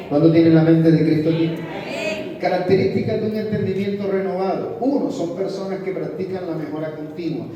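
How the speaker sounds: loud, driving sermon delivery; rallying the congregation at full voice; fiery preaching. Acted. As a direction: preach it out to the whole church.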